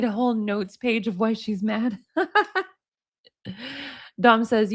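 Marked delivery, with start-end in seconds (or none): 2.0-2.6 high laugh. Real, not acted. Laughs at notes page.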